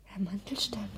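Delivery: soft night whisper; hushed whisper as husband comes. Acted. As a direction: whisper in the dark.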